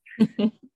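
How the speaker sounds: quick high laugh; short loud laugh. Real, not acted. Laughs after the imagined reply.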